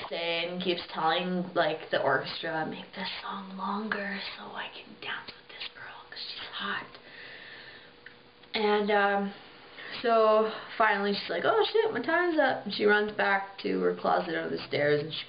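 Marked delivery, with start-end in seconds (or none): 2.7-6.9 softer, breathy secret whisper. Retold or imitated. King secretly instructs the orchestra. 11.4-12.6 sudden alarm. Retold or imitated. Girl realizes she's late.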